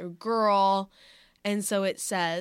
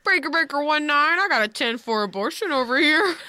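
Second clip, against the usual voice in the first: loud CB trucker call, higher; over a radio; 7 semitones higher, 10 dB louder than usual. Pretend.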